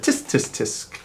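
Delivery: whispered tutting clicks; tsk tsk tsk. Acted. Monkeys tutting back at the peddler, whispered. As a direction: tut back mockingly.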